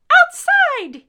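put-on very high, loud child's shriek. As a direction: shriek it like an excited little boy. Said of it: "he shrieked".

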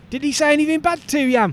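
chirpy Aussie bloke; aussie tradesman. Acted. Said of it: "it's Brian".

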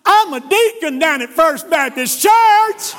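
loud, high, stretched-out mock wail; hammed-up shouted admission; blurted confession. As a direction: blurt it out at full voice in desperation. Flustered man blurts his church office.

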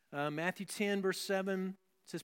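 ordinary talk

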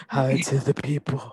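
slow, whispered sultry voice. Retold or imitated. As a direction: whisper it slowly, seductively. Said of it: "are you doing your sultry voice?".

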